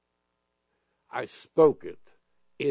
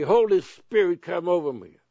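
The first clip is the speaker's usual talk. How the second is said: loud bold prayer; 5 semitones higher, 5 dB louder than usual. Shown as a demonstration. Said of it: "And then say".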